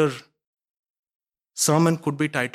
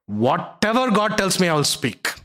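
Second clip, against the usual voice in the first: higher and louder, God punched; preacher's ringing repeat of the vow; loud declaration; 4 semitones higher, 4 dB louder than usual. Retold or imitated. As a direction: proclaim it boldly to the room. Balaam's vow, said twice.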